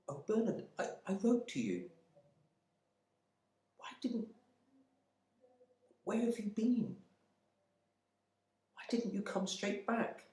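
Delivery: high, pleading voice of a shocked wife; frightened wife. Acted. Wife confronting her missing husband, high and pleading. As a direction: stammer out her hurt to the husband back from the dead. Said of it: "Her terrified eyes".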